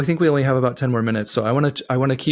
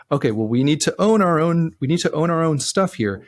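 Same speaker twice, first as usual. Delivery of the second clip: ordinary talk, nothing marked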